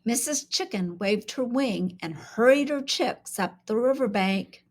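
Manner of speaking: plain reading voice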